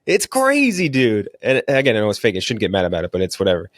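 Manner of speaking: ordinary talk, nothing marked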